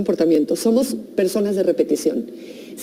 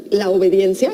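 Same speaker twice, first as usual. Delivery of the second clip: ordinary talk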